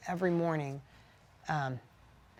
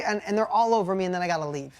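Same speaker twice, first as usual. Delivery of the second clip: ordinary talk, nothing marked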